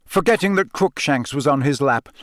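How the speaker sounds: usual speaking voice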